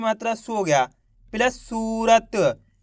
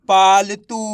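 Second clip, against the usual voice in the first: पालतू run together, firm and louder; blended word; 3 semitones lower, 5 dB louder, much slower than usual. Shown as a demonstration. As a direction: say पालतू as one joined word. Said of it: "तो मिलके बन जाएगा". (पालतू को मिलाकर एक शब्द में)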